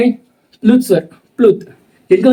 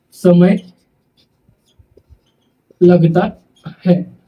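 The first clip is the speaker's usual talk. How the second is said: word by word with long pauses, for writing down; slow dictation; slower than usual. Shown as a demonstration. Teacher dictating notes word by word to students, slowly. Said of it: "teacher dictating slowly word by word".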